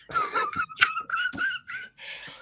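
breaks into a high, squeaky giggle; real burst of laughter at her own punchline; high squealing laugh. Real, not acted.

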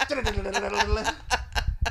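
high, rattling tongue-trill noise; rapid-fire imitation noise. Comic storyteller imitating Nintendo rapid fire, rattled off. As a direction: rattle off nonsense syllables like rapid button mashing. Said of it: "Like Nintendo Rabbit Fire".

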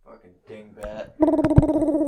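very high, slow teasing "dingbat"; high slow mock insult at a clumsy friend; drawn-out insult. Real, not acted. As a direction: stretch the insult out mockingly.

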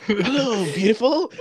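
playful fan imitation of a movie line; imitating Woody's line. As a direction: coo it like a creepy villain. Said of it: "he's like, 'Hello, beautiful.'".

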